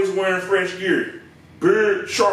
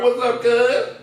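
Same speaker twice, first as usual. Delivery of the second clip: imitated greeting; 3 semitones higher, slower than usual. Comic imitating the customer's cuz greeting. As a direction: throw it out like a gang greeting. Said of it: "He was like".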